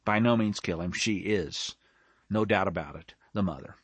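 low, hushed pronouncement; quiet verdict. Acted. Young king declaring who the real mother is, low and quiet. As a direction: drop right down, soft and certain. Soft verdict after acted story.

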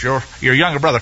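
ordinary talk, nothing marked

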